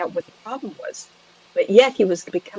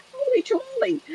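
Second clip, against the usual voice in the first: very high holy tamale; playful astonished squeal; retold exclamation; 4 dB quieter than usual. Psychic recalling her astonishment at the story, high exclamation. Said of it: "I went".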